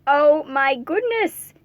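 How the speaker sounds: ordinary delivery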